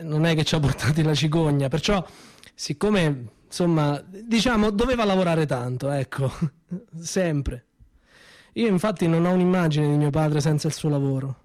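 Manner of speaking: ordinary talk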